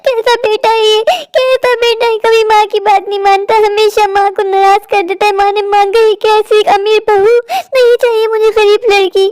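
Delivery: high-pitched, hammed-up lament; melodramatic wailing. Mother laments her disobedient son's bride choice, melodramatic wailing. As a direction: wail like a wronged mother making a scene. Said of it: "कैसे ड्रामे कर रही है अम्मा".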